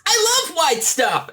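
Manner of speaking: high, slow squeaky Edward line; Edward impression. Host quoting Edward leaping into snow, high and slow. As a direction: stretch it out high and silly. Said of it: "and says".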